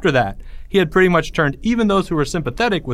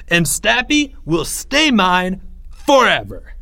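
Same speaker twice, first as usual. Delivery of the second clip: raised, slow and emphatic; triumphant proclamation; 6 semitones higher, slower than usual. A narrator's reading. Greg vows before the cheering crowd, triumphant. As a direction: stretch it out like a grand vow.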